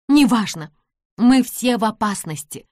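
loud urgent cry; girl urgently shouting to both men; urgent exclamation. A narrator's reading. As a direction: cut him off, we are in danger. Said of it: "воскликнула Нэнси".

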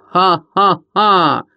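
slow, loud, mocking ha-ha-ha; loud villain guffaw. A narrator's reading. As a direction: neigh out a slow evil laugh. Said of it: "одинаково заржали своё". (злодейский громкий медленный хохот)

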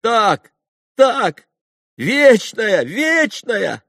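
very high, loud cheer; boys shouting. A narrator's reading. All the boys cry out eternal memory in answer, high excited shout. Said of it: "прокричали все мальчики".

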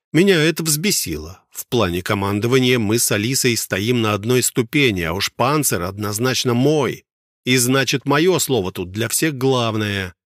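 usual speaking voice

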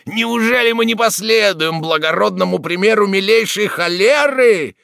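put-on very high, loud drunken fervour; rowdy rallying cry. A narrator's reading. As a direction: whip up the crowd. Drunken revellers rallying crowd.

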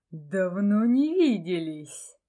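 slow, low and menacing; low hissing voice. A narrator's reading. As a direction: drag it out like a sinister shadow. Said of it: "низким шипящим голосом".

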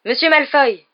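high, loud call after someone; breathless call. A narrator's reading. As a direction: call out urgently, as if winded. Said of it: "la voix haletante".

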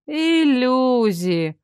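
very slow, stretched-out word; long, disdainful drawl; disapproving drawl. A narrator's reading. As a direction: draw the word right out in disapproval. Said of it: "протянула Шерон и с явным неодобрением".

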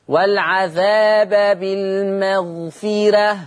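slow recitation of والعذاب بالمغفرة ending in thin "reh"; light ra. Shown as a demonstration. Teacher models Warsh's reading, slow with a light "reh" in بالمغفرة. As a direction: recite slowly, fronting the ra of بالمغفرة. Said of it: "هكذا".

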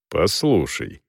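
low, cold and quiet; icy voice. A narrator's reading. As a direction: say it coldly, holding back anger. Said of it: "ледяным голосом проговорил Селдон".

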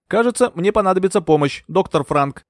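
lightly voiced, composed nurse; understated, imperturbable request; unruffled nurse. A narrator's reading. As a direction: ask for help without any alarm. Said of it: "невозмутимо проговорила медсестра".

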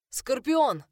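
loud, high battlefield call; booming shout. Acted. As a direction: thunder out the name. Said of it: "прогремел мужской голос".

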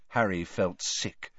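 usual speaking voice

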